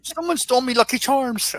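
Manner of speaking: high, hammed-up bad Irish accent; a deliberately bad Irish brogue. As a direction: do a goofy leprechaun brogue. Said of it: "Do that Irish accent again".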